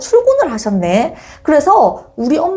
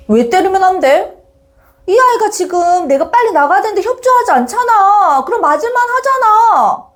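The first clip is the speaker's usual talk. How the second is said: high, whiny acted back-talk; indignant kid justifying himself in a raised pitch; protesting child; 10 semitones higher than usual. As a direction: argue back as an aggrieved brother. Brother arguing back at mother.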